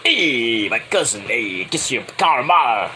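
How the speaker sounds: low, slow wiseguy voice; Tony D'Angelo voice. Retold or imitated. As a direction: lean in like a mob cousin. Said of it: "then we go to Tony D'Angelo".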